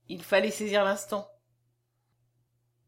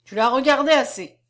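louder, higher firm order; 4 semitones higher, 8 dB louder than usual. A narrator's reading. Old man ordering the child away from the body. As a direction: put a stop to the child's staring. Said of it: "ordonna le vieillard".

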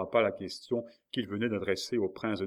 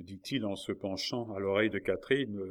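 usual speaking voice

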